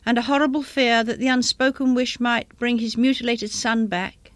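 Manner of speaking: ordinary delivery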